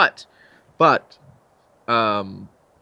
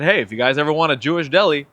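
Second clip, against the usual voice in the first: lightly raised, half-kidding offer; half joking, half serious; 4 semitones higher, 7 dB louder than usual. Retold or imitated. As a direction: pitch it as a half-serious joke. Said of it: "half joking, half serious".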